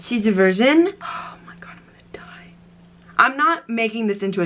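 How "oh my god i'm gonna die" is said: breathless whisper of dread; awed whisper. Real, not acted. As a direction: whisper it like you're about to face doom. Bracing before eating spicy noodles.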